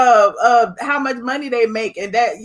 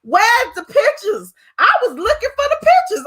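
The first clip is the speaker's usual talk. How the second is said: mock eager search; 8 semitones higher than usual. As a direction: ham up the eager hunt for proof. Mocking eager search for pictures.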